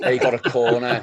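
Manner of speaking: teasing Cockney impression; mock Cockney. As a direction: imitate a Cockney football fan, half laughing. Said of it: "loving your Cockney accent there".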